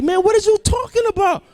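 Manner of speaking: high, baffled protest; incredulous. Retold or imitated. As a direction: pitch jumps up in disbelief. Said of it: "I'm like".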